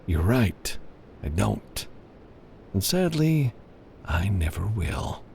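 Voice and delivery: quiet, slow, mock sorrow; a hint of sad menace; soft, drawn-out ending; lightly voiced regretful threat. A narrator's reading.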